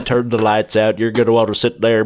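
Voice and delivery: ordinary talk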